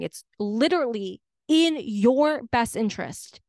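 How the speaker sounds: ordinary delivery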